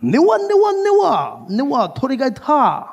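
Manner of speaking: high squeaky niwa niwa; a hammed-up high Japanese exclamation; excited mock Japanese. Shown as a demonstration. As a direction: yelp it out like pointing at chickens. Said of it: "I could also say".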